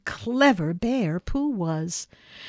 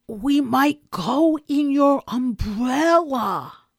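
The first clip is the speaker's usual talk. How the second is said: slow, high and loud; loud insistent repeat; 6 semitones higher, 5 dB louder, slower than usual. Acted. Bear tries the umbrella idea a third time, loud and insistent. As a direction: drive the idea home, stretching the words. Third try, finally gets through.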